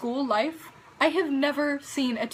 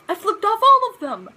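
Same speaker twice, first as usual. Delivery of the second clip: incredulous outburst; 9 semitones higher, 11 dB louder than usual. Retold or imitated. Indignant exaggeration of the accusation.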